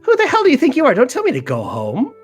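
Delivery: high, loud indignant pixie; angry pixie. Acted. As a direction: squeak it out furiously offended. Pixie Farum offended.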